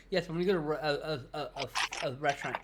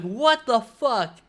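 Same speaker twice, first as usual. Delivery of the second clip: slow, much louder shocked outcry; shocked exclamation; 8 semitones higher, 12 dB louder, much slower than usual. Retold or imitated. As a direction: blurt it out, stunned. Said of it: "And I was like".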